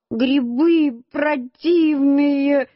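high, very drawn-out wail; crying child. A narrator's reading. Crying boy complaining to his uncle about mushrooms, high slow tearful whine. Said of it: "Я взял и ещё громче заплакал".

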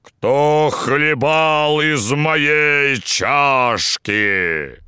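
slow, menacing bear roar; disguised deep voice. Acted. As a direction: growl it out like a huge bear. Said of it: "заревел страшным голосом".

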